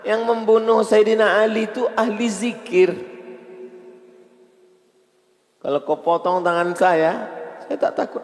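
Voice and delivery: high, loud outrage; preaching at full force. Acted. Preacher telling the congregation about Ali's killer, loud outrage. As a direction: cry it out to the whole mosque.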